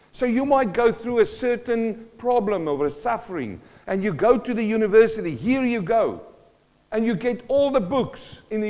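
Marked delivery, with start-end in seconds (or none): none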